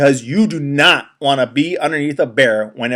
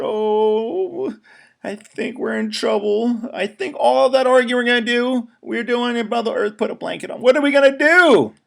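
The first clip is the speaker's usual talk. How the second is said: higher, anxious critter voice; worried forest animals; 10 semitones higher than usual. Acted. Animals fearing their arguing caused the dark, worried. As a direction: fret like a frightened little animal.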